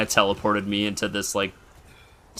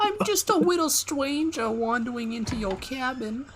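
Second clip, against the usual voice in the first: high, sing-song falsetto; mock-innocent squeaky little visitor; playful little-stranger voice. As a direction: put on a tiny, cutesy high voice. Plays little stranger entering cabin.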